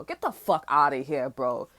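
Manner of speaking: low-pitched impression of how he talks; imitating his voice. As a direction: drop your pitch, mimic his dismissal. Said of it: "That's how he sound too".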